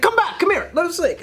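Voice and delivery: mock-eager street seller calling back a customer; pleading vendors. Pushy vendors calling him back.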